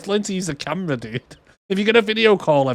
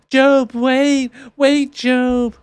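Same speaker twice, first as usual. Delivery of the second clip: mock shout; 10 semitones higher, 3 dB louder, much slower than usual. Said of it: "shouting Job to wait".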